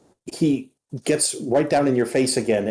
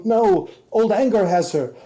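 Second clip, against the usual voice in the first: agitated revelation; 9 semitones higher than usual. Acted. Butterfly agitated.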